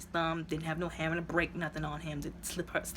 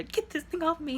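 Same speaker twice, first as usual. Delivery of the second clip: much higher, urgent imitated cry; mock frantic demand; 11 semitones higher than usual. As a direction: push it up into a near-panicked plea. Telling the brother, more urgent.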